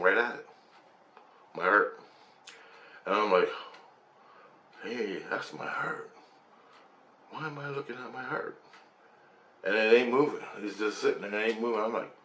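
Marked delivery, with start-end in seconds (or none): 4.8-8.6 hushed wonder. Retold or imitated. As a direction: ask it softly, dazed and amazed. Puzzled at seeing his own heart.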